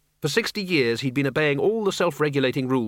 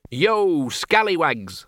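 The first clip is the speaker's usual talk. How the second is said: cocky yo; 4 semitones higher, much slower than usual. Acted. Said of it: "said the Dean".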